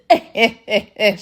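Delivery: mock cackling laugh; cackle. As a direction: cackle like a gossip. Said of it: "she get the cackling".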